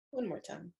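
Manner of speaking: very soft, almost under the breath; hushed cue. Acted. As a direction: murmur the cue softly. Quiet cue between toning.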